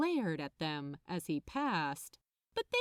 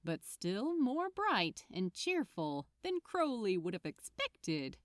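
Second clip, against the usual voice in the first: usual speaking voice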